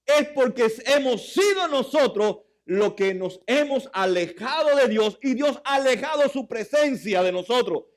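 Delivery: high, loud preaching; fiery sermon delivery; preaching at full force. Acted. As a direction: preach it out to the congregation.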